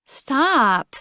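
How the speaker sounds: high, nasal playful protest; giggling nasal tease; nasally giggly girl. A narrator's reading. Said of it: "a nasally voice said with another playful giggle".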